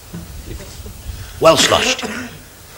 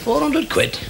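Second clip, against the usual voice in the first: high, quiet, squeezed mutter to himself; grumbling diver; 11 semitones higher, 7 dB quieter, slower than usual. Acted. Diver fumes underwater about the cost, high soft grumble. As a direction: pitch it right up, small and soft.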